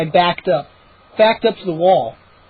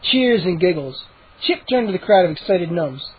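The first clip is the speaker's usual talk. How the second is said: plain reading voice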